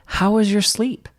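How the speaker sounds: put-on a touch of the caring toddler; sweet child. Son checking on parents.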